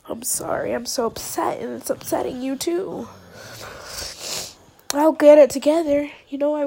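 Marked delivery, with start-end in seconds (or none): none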